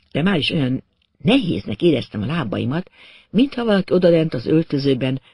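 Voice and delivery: usual speaking voice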